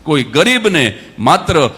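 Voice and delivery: loud, slow, drawn-out preaching over music; preaching climax. Acted. (ઉપદેશનો ચરમ, જોરથી ધીમે)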